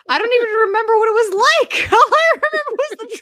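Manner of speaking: high laughing voice. Real, not acted. Laughing while admitting to a friend she remembers nothing. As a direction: talk up high through the giggles. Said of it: "laughing about childhood trauma".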